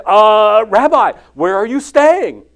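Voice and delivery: loud, slightly high nervous blurt; awkward blurted question. Acted.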